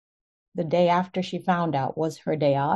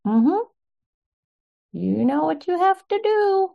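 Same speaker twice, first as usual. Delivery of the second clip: much higher, pointed knowing tone; knowing prod; 11 semitones higher, 3 dB louder than usual. Retold or imitated. Urging mom to report the facility, pointed and knowing. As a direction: nudge her firmly, you already know. Telling mom what she must do.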